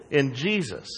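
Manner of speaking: usual speaking voice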